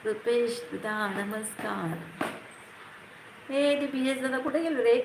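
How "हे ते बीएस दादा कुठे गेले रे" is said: raised voice calling out a question. Real, not acted. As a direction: call out across the room to find him. Streamer calling out for a viewer.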